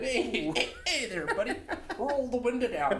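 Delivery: high, loose mock Irish-Canadian accent; Irish Canadian border officer. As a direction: hail a driver in a hammed-up Irish-Canadian officer's voice. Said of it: "this Irish Border Patrol dude".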